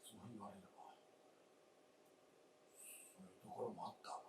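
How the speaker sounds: barely voiced, very soft and low; hushed admission. Husband quietly admitting his wife is right, hushed. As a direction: let it slip out almost under your breath.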